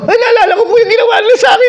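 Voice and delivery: very high, loud, fast crying yell; mock wailing shout. Preacher mimics the girl bawling at the altar, mock crying shout. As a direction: bawl it out in a hammed-up sob.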